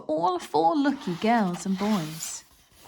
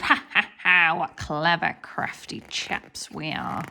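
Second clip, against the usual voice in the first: a mild mock chuckle, lightly voiced; sly chuckle; 4 semitones lower than usual. The two thieves gloating over their plan, mildly chuckling. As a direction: chuckle like a pleased crook. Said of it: "The two thieves chuckled".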